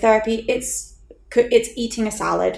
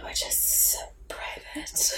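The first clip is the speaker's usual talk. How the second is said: hushed slow whisper; whispered aside; 12 dB quieter, much slower than usual. Real, not acted. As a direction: slowly whisper it like a secret. Secretive aside to co-host.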